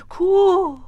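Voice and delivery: loud high amazed coo; crowd's coo. Acted. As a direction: gasp it out as the whole town. Whole crowd gasps.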